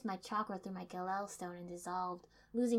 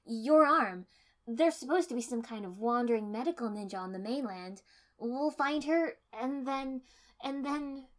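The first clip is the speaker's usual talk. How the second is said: desperate; 5 semitones higher, 9 dB louder than usual. Acted. Desperate pleading plan.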